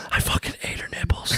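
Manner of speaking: hushed mock-sinister whisper; whispered confession. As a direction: whisper back an even grislier secret.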